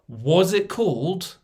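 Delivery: slow, drawn-out mock innocent ask; drawn-out wife question. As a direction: stretch the question out slowly, deadpan. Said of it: "the question my wife gave me".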